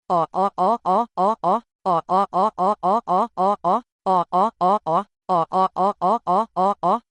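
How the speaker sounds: low rumbling beast growl in repeated bursts; creature growl. A narrator's reading. One-eyed dog beasts closing in on the party, low menacing growl. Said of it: "Os cães caules rosnaram".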